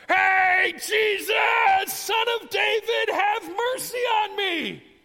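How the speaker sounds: high, drawn-out yell over a crowd; full-out shout. Acted. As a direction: holler at the top of your voice. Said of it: "he starts to shout".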